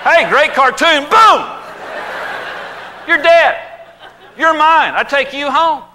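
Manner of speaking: loud, high, laughing hunter shouting; gleeful hunter. Pretend. Daniel Boone gleefully shooting Bambi, loud and high. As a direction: crow over the kill like a happy brute.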